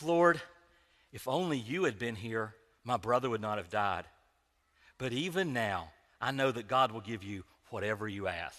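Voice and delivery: soft, low, measured pious reading; subdued and reverent, with careful pauses; reverent halo reading. Shown as a demonstration. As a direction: read it gently, as if she were a saint. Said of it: "how I read that is this".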